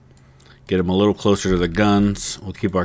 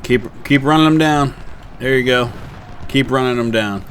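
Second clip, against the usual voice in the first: raised, eager encouragement; excited urging; 5 semitones higher than usual. Real, not acted. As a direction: cheer the troops on, pitch up. Urging troops on in battle.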